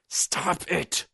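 high, strained hissed command; tense, high-pitched hiss. A narrator's reading. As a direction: hiss it urgently to shut someone up. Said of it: "Roop hissed".

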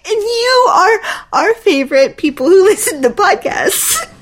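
high, giggling delivery of the line; laughing through it. Real, not acted. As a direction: crack up mid-sentence.

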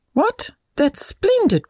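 put-on high-pitched storybook girl's exclamation; delighted child, voiced by a narrator; excited cry. A narrator's reading. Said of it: "cried Kate".

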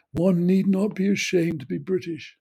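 mock her affected posh tone with a sneer; Thatcher's snobby voice. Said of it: "in that ghastly, snobby, artificial voice".

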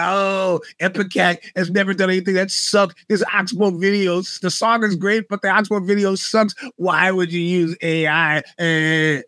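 whiny griping of an imitated critic; mock complaining. Imitating Luddites griping about an AI video, mock complaining. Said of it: "Luddites are complaining".

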